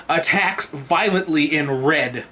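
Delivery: ordinary talk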